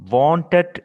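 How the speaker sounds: "wanted" with the middle T sounded; full pronunciation. Shown as a demonstration. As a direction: say "wanted" as "wan-ted". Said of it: "is the correct pronunciation".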